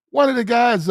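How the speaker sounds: blurting the restart over his own stumble. Shown as a demonstration. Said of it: "So anyhow, one of the guys".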